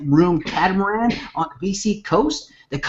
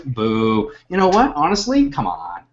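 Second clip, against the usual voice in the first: ordinary delivery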